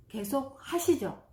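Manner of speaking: ordinary delivery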